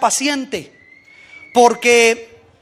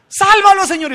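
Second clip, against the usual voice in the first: mock cry for help; 8 semitones higher, 6 dB louder than usual. Crying out for drowning Jonah.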